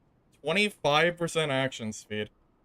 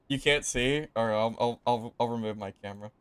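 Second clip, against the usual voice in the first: normal talking voice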